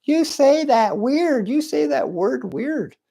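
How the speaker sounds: very high mock complainer's voice; drawn-out falsetto imitation of critics. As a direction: squeal it like a silly nitpicker. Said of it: "But some people tell me".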